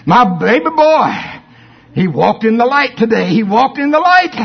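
high, loud proud boast; beaming pride over the baby boy; proud excitement. Retold or imitated. God boasts to heaven about his child, high proud voice.

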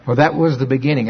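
usual speaking voice

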